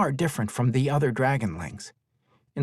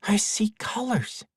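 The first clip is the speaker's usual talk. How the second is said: put-on child voice; 10 semitones higher than usual. Small boy in wonder.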